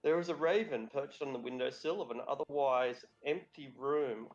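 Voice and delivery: usual speaking voice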